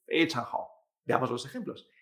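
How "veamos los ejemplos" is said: normal talking voice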